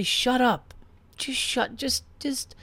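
high, soft, sugary hush; mock polite hush. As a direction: shush her with fake sweetness. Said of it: "I was just trying nicely to just say".

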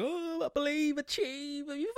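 high, airy put-on mantra; mocking motivational talk. Mocking motivational slogans, airy. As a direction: parody a guru. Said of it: "people go on about".

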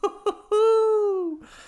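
giddy high-pitched laugh; high giggle. Real, not acted. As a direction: burst into a shrill giggle. Laughs after the conversation.

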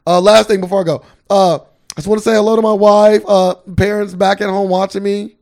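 loud, stiff robotic thank-you speech; hammy athlete shout-out to family; imitating Tom Brady. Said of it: "he said at the end".

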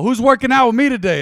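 loud, high call across the gym; retold shout. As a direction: boom it out to the whole room. Gym guy calls out loudly.

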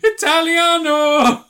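very high, loud, drawn-out Italian shout; jolly Italian pizza chef. Pretend. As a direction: belt it like a cartoon chef. Italian stereotype voice.

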